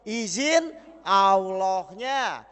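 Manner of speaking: very slow, drawn-out answer; slow punchline. Real, not acted. Preacher answering his own question, slow and weighty. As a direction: stretch every syllable for weight.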